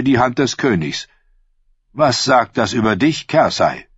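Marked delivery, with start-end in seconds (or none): none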